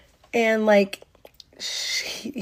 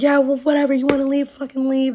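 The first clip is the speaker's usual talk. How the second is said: high, raised mock dismissal; not nice anymore; 4 semitones higher, 5 dB louder, faster than usual. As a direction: imitate a boyfriend snapping back. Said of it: "he's not even being nice anymore".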